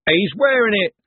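high call from the crowd; heckling shout. A narrator's reading. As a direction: shout out the gag like a wag. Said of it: "shouted a wag in the crowd".

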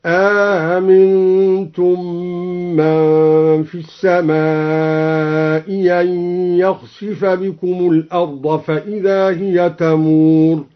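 أأمنتم with softened second hamza and an alif between, slow; slow chant: أاآمنتم eased; recited with eased hamza. Shown as a demonstration. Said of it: "بتسهيل الهمزة الثانية مع إدخال ألف هكذا". (ترتيل بتسهيل الهمزة الثانية في أأمنتم)

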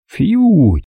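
a light high puff of air, like a silenced shot; airy silenced-gun phew. A narrator's reading. Narrator imitating a silenced pistol shot, airy puff. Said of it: "лёгкое, как воздух".

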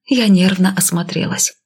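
usual speaking voice

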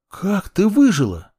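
high, startled question; surprised. Acted. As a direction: gasp it out in disbelief. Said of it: "удивлённо спросила девушка".

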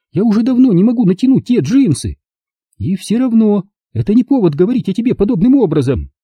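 high heated voices; arguing women. Acted. Lauren and mother bicker at dinner, high voices. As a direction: argue back and forth. Family argument at table.

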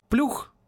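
high rising plop; a quick high plop; splash sound. A narrator's reading. Spirit's splash reply.

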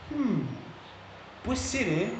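high, soft, half-persuaded musing; duda crédula. Acted. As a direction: wonder aloud, softly giving in. Acts out himself being half persuaded. (duda en voz aguda y baja)

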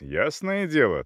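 plain reading voice

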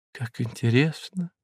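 soft, high chirp. A narrator's reading. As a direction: chirp it lightly. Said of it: "прощебетала госпожа Мозес".

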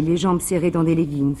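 usual speaking voice

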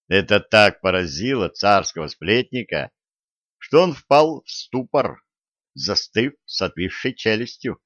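ordinary delivery, nothing marked

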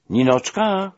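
high, loud joyful call across the street. A narrator's reading. Narrator calling out to old friend Nina. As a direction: call her name in happy recognition. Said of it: "zawołałem".

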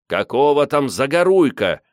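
indignant heckle; shout from the crowd. A narrator's reading. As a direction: call out indignantly over the crowd. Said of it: "несётся из толпы".

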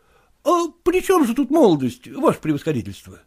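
выше, молодой проситель недоумевает; недоумённо переспрашивает; голос Куцина. Acted. Kutsin asks, general repeats. (Куцин недоумённо спрашивает генерала, голос выше)

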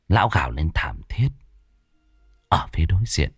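hushed whisper; breathy horror whisper; whispered narration. A narrator's reading.

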